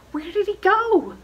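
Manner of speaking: curious wondering. A narrator's reading. Child wonders aloud in story.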